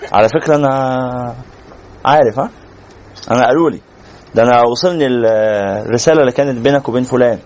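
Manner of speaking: a lightly voiced smug snoop; smug know-it-all. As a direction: play the nosy busybody who lets you know he knows.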